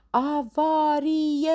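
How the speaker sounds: high, loud, long-drawn cry of alarm; alarmed shout. Acted. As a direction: sound the alarm, stretching it out. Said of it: "strigă Limonadă". (strigăt de alarmă prelung)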